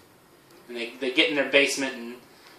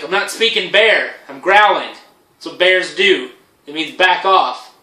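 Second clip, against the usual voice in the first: louder, exasperated explaining; mock irritated bear; 4 semitones higher, 9 dB louder than usual. Bear explains itself loudly.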